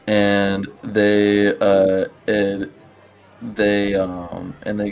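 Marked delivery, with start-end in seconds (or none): none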